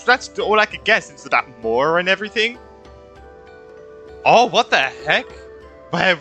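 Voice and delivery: normal talking voice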